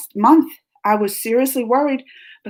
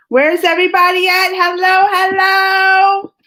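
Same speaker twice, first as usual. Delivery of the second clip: high, loud, drawn-out hellos; calling out; 8 semitones higher, 6 dB louder than usual. Real, not acted. Streamer looking for her viewers, calling out. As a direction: holler hello to find the crowd.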